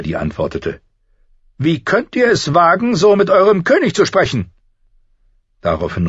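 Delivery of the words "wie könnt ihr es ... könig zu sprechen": high, loud scolding queen; haughty indignant queen. Acted. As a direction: snap back at him, outraged. Queen rebukes Ned.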